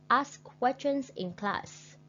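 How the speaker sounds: slow, high, careful phrase; slow model reading. Shown as a demonstration. Teacher reading the strategy slowly with pauses. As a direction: read it slowly with clear pauses. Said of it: "take the correct pauses".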